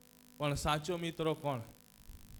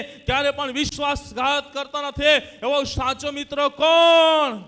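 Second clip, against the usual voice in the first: raised, chant-like questioning that builds louder; rousing preaching; 11 semitones higher, 13 dB louder than usual. Real, not acted. Preacher asking who the true friend is, rousing preaching. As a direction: work the crowd up to answer.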